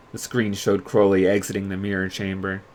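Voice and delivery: ordinary delivery, nothing marked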